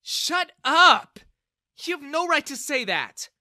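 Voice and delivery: high, loud shout. Acted. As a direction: cut him off to defend Ethan. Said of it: "Bella intervened".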